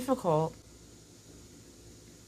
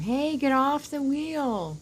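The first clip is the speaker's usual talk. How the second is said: high, slow scolding of a pet; calling out to pet; 10 semitones higher, 3 dB louder, slower than usual. Real, not acted. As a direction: playfully shoo him off. Telling the mouse off.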